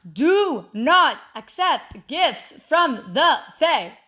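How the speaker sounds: a dead-serious warning to viewers; slow emphatic warning. Real, not acted. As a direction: lay down the big rule, word by word. The big do not warning.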